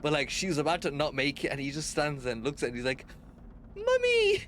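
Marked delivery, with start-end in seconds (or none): 3.8-4.4 very high, loud mimicked kid's cry; high-pitched weird voice. Streamer imitating a kid calling his mum, absurdly high-pitched. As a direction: squeal it like a ridiculous kid calling. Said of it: "in the most high-pitched, weird-ass fucking voice".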